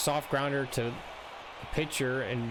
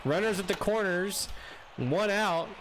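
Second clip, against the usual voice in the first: live play-by-play; 5 semitones higher than usual. Acted. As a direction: call the hit like a broadcaster.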